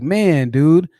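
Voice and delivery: mock bro hype. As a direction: praise like a hyped-up friend. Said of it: "if you say, like".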